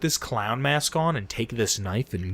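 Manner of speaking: low, hushed villain orders; quietly sinister, pitched well down; hint of a creepy game villain. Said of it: "talking in this creepy fucking German accent".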